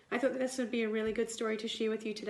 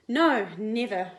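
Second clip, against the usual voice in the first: firm reassurance; 7 dB louder, slower than usual. Acted. Mother firmly reassures.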